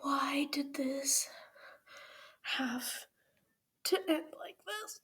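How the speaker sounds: real tearful whisper; crying. Real, not acted. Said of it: "I shouldn't be crying".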